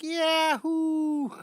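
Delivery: loud, very high, stretched whoop; delighted high-pitched yell; scream of delight. Acted. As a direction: holler as a thrilled cartoon girl. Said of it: "screaming with delight. She's like".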